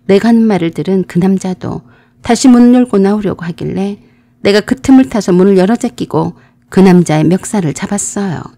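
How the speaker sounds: usual speaking voice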